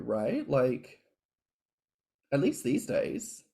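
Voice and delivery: usual speaking voice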